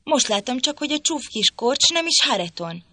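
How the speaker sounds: startled realisation, voice lifted; surprised exclaim. A narrator's reading. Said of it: "kiáltott fel". (meglepett felkiáltás, magasabban)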